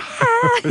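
sudden high yelp; tic yell. Retold or imitated. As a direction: let out a sharp high cry. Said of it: "you just hear me".

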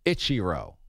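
"Ichiro" as a low, soft ITCHY-roh; whitewashed pronunciation. Retold or imitated. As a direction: imitate the announcer's Anglicized "Ichiro", short 'itch' first. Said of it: "whitewashed there".

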